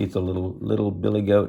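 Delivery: usual speaking voice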